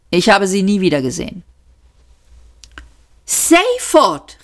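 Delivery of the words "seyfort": high-pitched cry of a name; exclamation. A narrator's reading. Ford calls out his friend's name, exclaiming. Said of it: "rief Ford aus".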